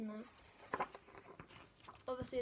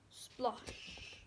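ordinary delivery, nothing marked

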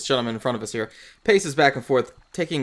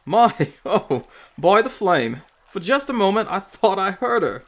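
higher, louder, startled put-on accent; startled old man; 7 semitones higher, 7 dB louder than usual. Old man looks up at a stranger, startled. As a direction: play the old man caught off guard, voice jumping up.

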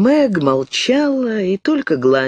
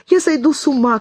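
high, frantic cry; girl in the dark shouting in terror; screaming; 11 semitones higher, faster than usual. A narrator's reading. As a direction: cry out in panic. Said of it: "кричала она".

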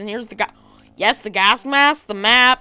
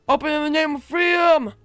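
shouting stranger; 5 semitones higher than usual. Retold or imitated. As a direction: call it out like an armed stranger at the door. Voicing a shouting group outside.

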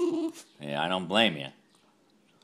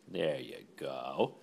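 low, slow, gentle release; quiet low there-you-go; soft low; 6 semitones lower, 7 dB quieter, slower than usual. Real, not acted. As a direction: hand over the treat softly. Handing treat, low soft.

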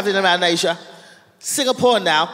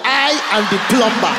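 mock Nigerian accent. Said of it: "Nigerian accent".

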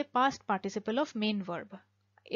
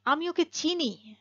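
firm stress on ওকে; emphatic Bengali; 5 semitones higher, slower than usual. Shown as a demonstration. Teacher stressing "আমি ওকে চিনি" for the class. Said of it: "যদি আমরা খুব জোর দিয়ে বলি".

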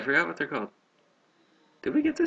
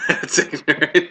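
laughing; 5 semitones higher, 6 dB louder, slower than usual. Pretend. Mocking, laughing.

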